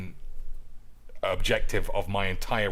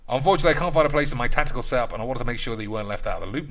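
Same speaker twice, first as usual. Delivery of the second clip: rushed option read; much faster than usual. Pretend. Reading dialogue options fast.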